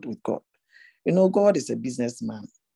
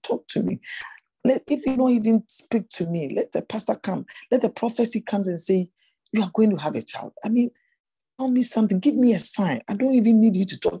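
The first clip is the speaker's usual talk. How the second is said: desperate plea; 6 semitones higher than usual. Retold or imitated. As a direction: beg God for a sign, voice rising. Retold desperate prayer to God.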